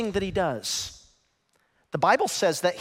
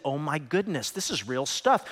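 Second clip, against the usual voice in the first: usual speaking voice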